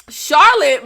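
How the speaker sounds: ordinary talk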